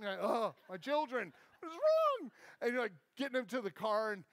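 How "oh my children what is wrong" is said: mock exasperation climbing to a high, loud squeal; frazzled parent at the end of their rope; exasperated parent. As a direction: wail at your misbehaving kids. Exasperated parent voiced in story.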